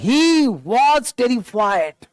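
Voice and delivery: very slow, word by word; deliberate spelled-out reading; slow read-aloud. Shown as a demonstration. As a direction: draw out He was terrified. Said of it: "तो कह रहा है".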